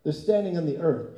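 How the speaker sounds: usual speaking voice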